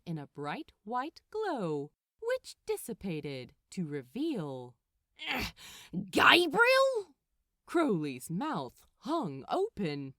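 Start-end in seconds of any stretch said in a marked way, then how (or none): 5.3-7.1 high, loud disbelieving cry; can't believe who it is; shocked disbelief. A narrator's reading. Crowley seeing Gabriel in his bedroom, loud high shock.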